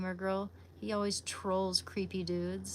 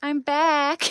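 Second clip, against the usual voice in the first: loud happy announcement; 7 semitones higher, 12 dB louder than usual. Real, not acted. Returning to the stream.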